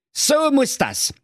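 exclaim, then rush on; high cranky old-man exclamation; Wilhelm calling out. Acted. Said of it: "rief der Wilhelm".

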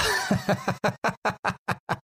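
high, wild burst of laughter; unbridled laugh. Acted. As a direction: let loose an uncontrolled laugh. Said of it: "Holzer lacht auf einmal zügellos". (Holzer bricht in zügelloses Gelächter aus)

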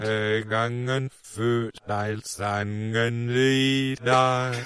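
garbled song played forwards; slurred, warped singing; reversed recording. Shown as a demonstration. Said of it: "rückwärts gesungenes, vorwärts abgespielt". (verzerrt abgespielter Gesang)

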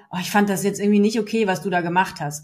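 fast, offhand mild criticism; light quick remark. Shown as a demonstration. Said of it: "und nicht nur so". (schnell und beiläufig)

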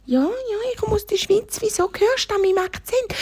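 very high hare voice; little hare. Acted. Hare tells cheetah where it's from, very high. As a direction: answer up high as a small hare.

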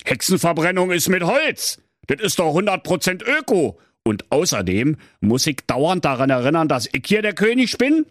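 high, bored grousing king; grumbling king. Acted. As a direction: whine from the throne. King voiced in story. (hoher, motzender, gelangweilter König)

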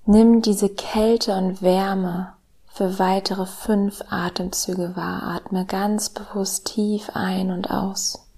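slow, soft meditation voice with whispered lines; calm guided meditation, hushed and slow; soft spa whisper. Acted.

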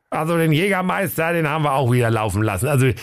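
high-pitched reporting sidekick; comic knight-officer figure; Prinz Meisenherz. A narrator's reading. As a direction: report to the boss in a high put-on voice. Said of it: "Ritter Prinz Meisenherz".

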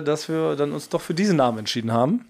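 normal talking voice

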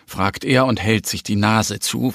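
ordinary delivery, nothing marked

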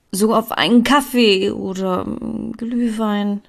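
hesitant, drawn-out invitation that peters out; stammering, fading. A narrator's reading. Asking Emilia out for coffee, stammering and fading. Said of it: "stammelte ich, und meine Stimme wurde mit jedem Wort ein bisschen leiser".

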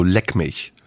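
low, curt brush-off; deadpan low rebuff; dismissive leck mich. Shown as a demonstration. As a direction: drop the voice, shrug it off. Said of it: "antworte ich nur noch mit".

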